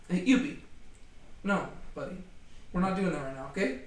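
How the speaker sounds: soft aside to the dog; quiet firm word to a dog; rebuking the dog. Real, not acted. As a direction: scold the pet under your breath. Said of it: "we're having to rebuke my dog".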